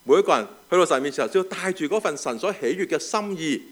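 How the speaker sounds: loud, high, emphatic preaching. Acted. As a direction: preach it out to the congregation. Preaching the sermon at full force.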